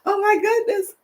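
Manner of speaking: high, breathless after laughing; laughing exclamation. Real, not acted. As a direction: gasp it out still laughing.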